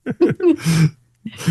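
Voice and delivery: high, laughing pretend begging; laughing mock plea. Host begging the lost viewer Peter to return, laughing mock plea. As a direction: beg through laughter.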